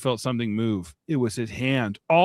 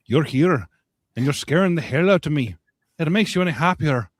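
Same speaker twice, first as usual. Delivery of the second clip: put-on loud, slightly fast Irish accent; 3 semitones higher, 5 dB louder than usual. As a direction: push the Irish accent hard. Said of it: "That's Irish".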